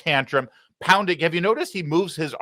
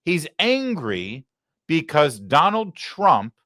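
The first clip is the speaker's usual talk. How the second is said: ordinary talk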